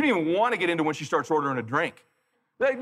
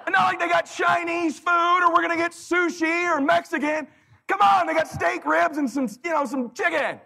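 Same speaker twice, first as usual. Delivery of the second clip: exasperated shout; 13 semitones higher than usual. Retold or imitated. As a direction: yell in disbelief at her. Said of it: "I'm like".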